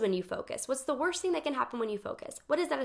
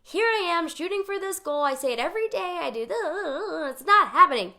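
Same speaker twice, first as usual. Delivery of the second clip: higher, louder, exasperated ending in a cry; a mock tantrum over unmet goals; frustrated outburst; 6 semitones higher, 6 dB louder than usual. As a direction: vent the stuck feeling, building up. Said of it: "the energy that I started to get into".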